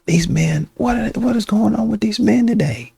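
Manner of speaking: put-on high-pitched gossiping girlfriend. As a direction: play it like venting to your girls in a high voice. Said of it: "go to your girls and you say".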